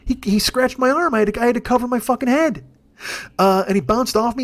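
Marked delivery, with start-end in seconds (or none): none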